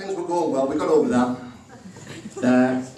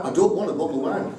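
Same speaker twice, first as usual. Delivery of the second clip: mimicking wife; 3 semitones higher, faster than usual. Wife refuses wine.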